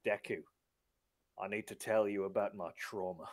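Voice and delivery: soft, low, grave Australian hero; hushed mock confession. All Might confiding trauma to Deku, hushed and grave.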